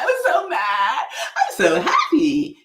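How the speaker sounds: loud, high, laughing overacted mad then happy; big laughing mock outburst; exaggerated emotions. Shown as a demonstration. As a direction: ham up each feeling with a shout. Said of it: "You can always exaggerate your emotions".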